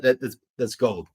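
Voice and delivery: ordinary talk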